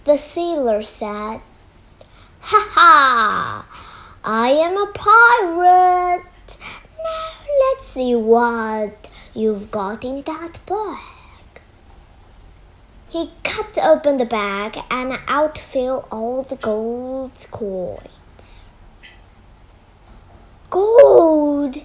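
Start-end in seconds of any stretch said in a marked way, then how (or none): 2.4-11.2 loud gloating pirate. Acted. Pirate reveals himself. 20.7-21.8 loud greedy shout. Acted. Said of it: "shouted the pirate".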